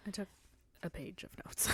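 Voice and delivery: breathless aside; whispering. Real, not acted. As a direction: drop to a whisper. Modest joke about her notes.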